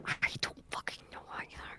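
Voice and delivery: frantic whispered retort; urgent whisper. Acted. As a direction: whisper back, just as baffled. Answers confidentially, equally baffled.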